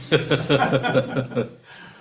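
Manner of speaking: hearty real laugh; burst of laughter. Real, not acted. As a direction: laugh out loud at the joke. Laughing at cartoon take.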